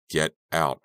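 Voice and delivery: ordinary delivery, nothing marked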